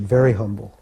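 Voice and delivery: usual speaking voice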